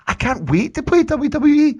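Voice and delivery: high, loud sarcastic cheer; mock excitement. Host mocks the game with fake excitement. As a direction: fake eager shout.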